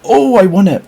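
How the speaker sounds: high excited shriek of a win; shrieking jackpot winner. Real, not acted. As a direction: squeal it out, you just won. Outbid auction win surprise.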